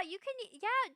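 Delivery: ordinary delivery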